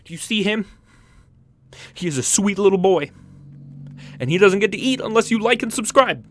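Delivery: high, loud, whiny desperate parent; hammed-up high pleading voice; funny voice. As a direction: wail about the starving plushies. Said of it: "if I do a funny voice".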